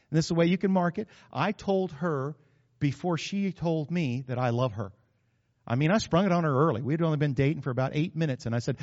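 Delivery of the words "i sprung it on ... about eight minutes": usual speaking voice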